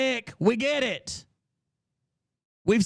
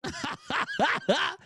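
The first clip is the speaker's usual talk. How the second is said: high shrieking laugh; 13 semitones higher, 6 dB quieter than usual. Real, not acted. Laughing hard at the streamer's threat, a high shriek.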